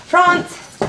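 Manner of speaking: high call across the room. Real, not acted. Calling across the office for France. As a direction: yell his name to find him.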